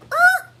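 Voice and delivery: high loud startled uh; mock hesitant grunt. Astrologer mimicking a Libra balking at a job offer, loud high uh. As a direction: let out a loud squeaky uh. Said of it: "You're like".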